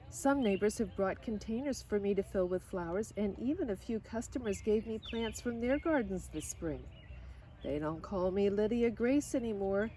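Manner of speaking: usual speaking voice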